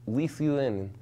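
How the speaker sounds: badly faked Lithuanian accent; attempted Lithuanian accent. Shown as a demonstration. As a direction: try Lithuanian and land on Borat. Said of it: "no, that's Borat".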